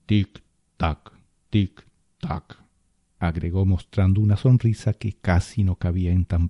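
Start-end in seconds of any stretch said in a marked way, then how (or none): 0.1-2.6 taunting tick-tock. A narrator's reading. Spirit taunting with a grin.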